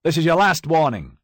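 loud, high final warning; stern judge. Acted. Judge warning the accused, loud and stern. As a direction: lay down the law from the bench.

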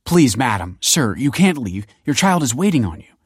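anxious entreaty; urgent pleading. A narrator's reading. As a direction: beg them urgently to stay. Pleading with parents storming out.

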